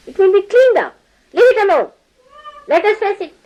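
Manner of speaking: high mock scolding. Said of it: "the face goes like that".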